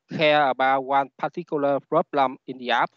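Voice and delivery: ordinary talk, nothing marked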